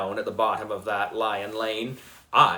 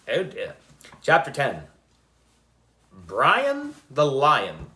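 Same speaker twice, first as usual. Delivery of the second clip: ordinary delivery, nothing marked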